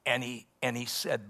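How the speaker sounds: normal talking voice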